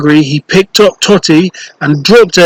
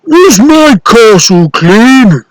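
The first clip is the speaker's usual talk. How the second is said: slow, loud, higher giant call; giant's yell; 6 semitones higher, 4 dB louder, slower than usual. Acted. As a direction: demand it across the castle. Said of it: "the giant would yell".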